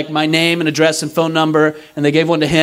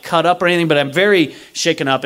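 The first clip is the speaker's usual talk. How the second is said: ordinary delivery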